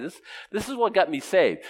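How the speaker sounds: ordinary talk, nothing marked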